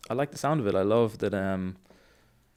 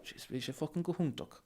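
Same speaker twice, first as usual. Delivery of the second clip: Irish slang; 7 semitones higher, 8 dB quieter than usual. Shown as a demonstration. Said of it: "you can say, like".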